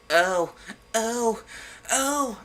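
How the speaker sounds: high, hammed-up oh oh oh; mock ecstatic moans. A narrator's reading. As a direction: ham up the fake passion cries. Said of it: "I screamed".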